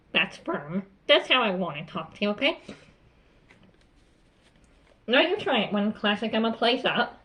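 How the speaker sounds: ordinary delivery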